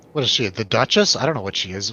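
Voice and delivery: ordinary talk, nothing marked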